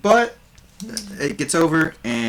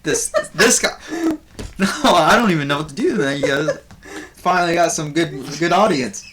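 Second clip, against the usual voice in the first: raised, louder comic bard voice; bard doing stand-up; 5 semitones higher, 4 dB louder than usual. Acted. Game master voices the bard Buvor greeting the audience, higher and louder. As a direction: Buvor working the crowd as a nervous comedian. Bard telling a flood joke.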